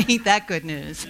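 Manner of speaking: chuckling through the refrain; laughing. Real, not acted. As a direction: laugh it out as a joke on yourself. Joking after the creed.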